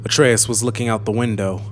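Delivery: ordinary delivery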